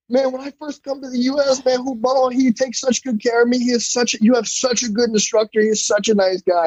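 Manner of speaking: a hint of the Brazilian coach raving; imitating the coach. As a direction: gush like a grateful coach.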